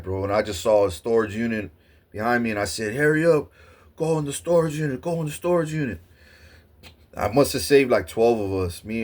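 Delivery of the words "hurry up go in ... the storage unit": high, fast, pushed urgent call; panicked leader herding people to safety; urgent shouted order. Retold or imitated. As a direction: hurry everyone in, hurry them. Urging friends into hiding.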